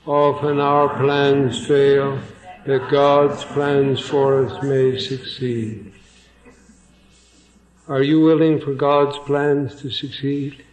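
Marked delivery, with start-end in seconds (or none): 0.1-5.9 slow, measured line for the congregation to join; deliberate, evenly paced group recitation; leading a recitation. Shown as a demonstration. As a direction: say it slowly so everyone can follow along. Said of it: "will you say that with me once again".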